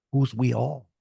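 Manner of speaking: ordinary delivery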